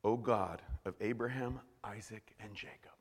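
soft, low, unhurried prayer; simple address. Acted. Elijah at the altar addressing God, calm and quiet. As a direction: speak quietly and plainly, no pleading. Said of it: "He just addressed his prayer".

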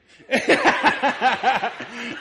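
big high laugh; loud burst of laughter. Real, not acted. Pastor cracking up before the church, loud laughter. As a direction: pastor cracking up at his own story. Retelling a funny story.

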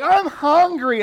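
put-on high, drawn-out whine of a hungry kid; hammed-up kid complaint; whiny kid. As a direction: mimic a child whining for food. Kids whining they're hungry.

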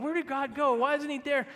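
high-pitched bewildered questions; imitated anxious searching; plaintive questioning. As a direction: voice a believer at a loss, pitched well up. Voicing someone who feels God absent.

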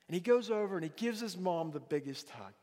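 normal talking voice

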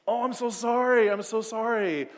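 mock apology after crashing the friend's car; hammed-up apology. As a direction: play up the sorry.

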